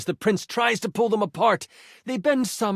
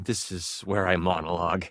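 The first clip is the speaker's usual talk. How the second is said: usual speaking voice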